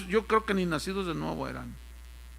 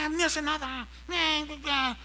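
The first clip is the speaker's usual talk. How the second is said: higher, dismissive muttered voice; mocking bored congregants; 8 semitones higher, slower than usual. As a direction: toss it off like bored churchgoers. (voz burlona de hermanos aburridos)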